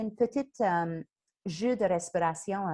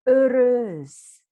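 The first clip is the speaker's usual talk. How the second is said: joyeux éclat; 3 semitones higher, 10 dB louder than usual. Shown as a demonstration. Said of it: "Avec un sourire".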